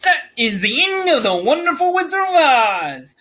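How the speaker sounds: high, swooping theatrical sign-off; high-pitched, hammed-up finale flourish; grand closing announcement. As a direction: announce the ending in big sweeping pitch arcs. Announces the end of story.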